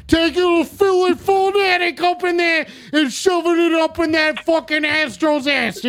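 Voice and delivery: a caller asked for it, so a Philly fan bellows at the Astros; Philadelphia Bruiser accent. Acted. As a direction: yell it like a rowdy Philly bruiser cheering his team. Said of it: "what the Philadelphia Bruiser sounds like".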